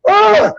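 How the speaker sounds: very high squeaky yawn; bird yawn imitation. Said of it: "he looks at me".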